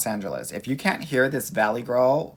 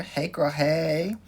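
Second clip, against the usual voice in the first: sing-song greeting; 6 semitones higher, much slower than usual. Real, not acted. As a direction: greet her like a bestie. Greeting a live viewer.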